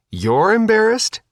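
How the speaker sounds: high, rising incredulous retort; indignant disbelief. A narrator's reading. Girl being disarmed retorts to her sister, indignantly incredulous. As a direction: throw it back, climbing sharply in pitch.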